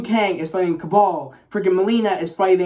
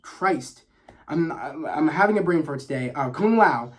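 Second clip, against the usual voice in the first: normal talking voice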